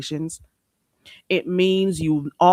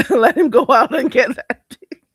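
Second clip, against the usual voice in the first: laughing; 6 semitones higher, 8 dB louder than usual. Real, not acted. Laughing at own joke.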